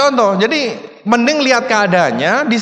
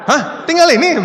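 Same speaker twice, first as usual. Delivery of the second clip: shocked disbelief; 8 semitones higher than usual. Retold or imitated. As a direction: react as if you can't believe only this is left. Quoted shocked reaction to drawing. (kaget tak percaya, suara tinggi)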